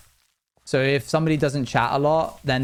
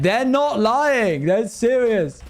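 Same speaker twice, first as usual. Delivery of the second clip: high, louder, drawn-out disbelief; mock astonishment; 10 semitones higher, 4 dB louder, much slower than usual. Streamer checking a lurker's name, hammed-up astonishment. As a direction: play up the amazed discovery, slow and high. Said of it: "I click on their name and I'm like".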